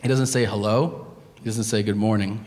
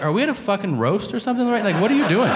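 quick, high-pitched incredulous comeback; indignant; 7 semitones higher, much faster than usual. Retold or imitated. Patient challenging his insulting doctor, indignant. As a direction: fire it back in mock outrage.

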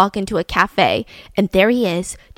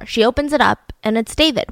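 ordinary delivery, nothing marked

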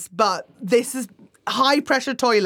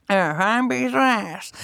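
toothless catchphrase. Retold or imitated. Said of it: "he's got a catchphrase".

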